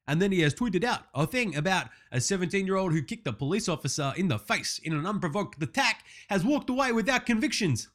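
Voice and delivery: ordinary talk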